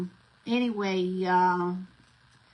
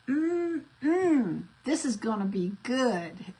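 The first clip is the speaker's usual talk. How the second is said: high savoring hum, then happy words; coaxing a kid to sound eager to taste; relishing demo; 3 semitones higher, much slower than usual. Shown as a demonstration. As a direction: show him how to sound delighted by food. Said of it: "I want you to think that this is gonna be good".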